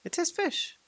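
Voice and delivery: high creature cry, rising at the end; Gollum's triumphant guess. A narrator's reading. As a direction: squeal it out in triumph.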